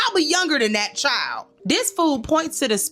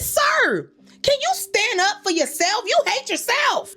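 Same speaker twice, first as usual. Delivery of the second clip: squeaky, much higher disbelieving plea; exasperated retort; 11 semitones higher than usual. Retold or imitated. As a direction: shriek at him in disbelief.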